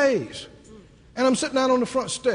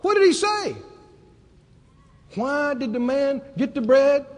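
normal talking voice

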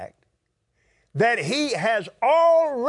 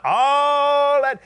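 shouted rebuke, louder and higher; 6 semitones higher, 6 dB louder than usual. Real, not acted. Preacher scolding wasted prayer time, shouting. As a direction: belt it out at the congregation.

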